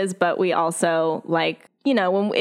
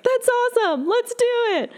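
much higher, breezy excited voice; laid-back player. Retold or imitated. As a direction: chirp it like an eager player. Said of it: "everyone's pretty cool with, like".

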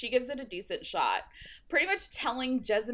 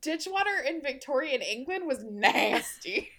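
high, louder, gleeful; mock agreement; 5 semitones higher, 4 dB louder than usual. Host cheering Tessa's insult, high and gleeful. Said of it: "I'm like".

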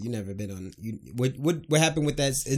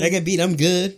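louder, higher, emphatic; loud insistence; 6 semitones higher, 6 dB louder than usual. Real, not acted. Friend defending being spanked, louder insistent claim. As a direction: hammer it home that he turned out fine.

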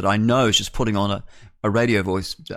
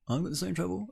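muttered aside; 8 semitones higher, 10 dB quieter, faster than usual. Shown as a demonstration. Host repeats line as aside.

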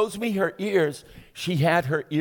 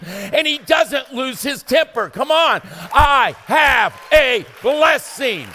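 high, loud rising sermon climax; full-force preaching; 5 semitones higher, 4 dB louder than usual. Acted. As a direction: build the congregation up to a shout.

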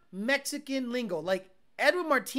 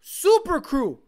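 slow, loud, higher boss voice; mock cartel boss; 6 semitones higher, 12 dB louder, slower than usual. Streamer as cartel boss ordering a truck, slow loud. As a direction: spell out the order like a demanding boss.

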